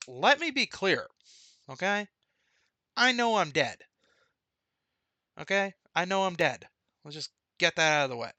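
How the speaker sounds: high, loud and emphatic; insistent brother. Retold or imitated. Twin brother insisting he knows he is dead, high and loud. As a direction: pin him down with each word.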